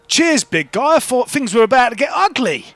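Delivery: high-pitched, relieved thanks; grateful shopkeeper. Acted. Shopkeeper thanking the big man after the row, relieved.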